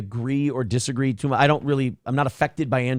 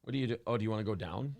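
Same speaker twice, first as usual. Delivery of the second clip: much softer, gentle coaxing; soft coaxing of the dog; 4 semitones lower, 13 dB quieter, much faster than usual. Real, not acted. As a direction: coax the dog down quietly.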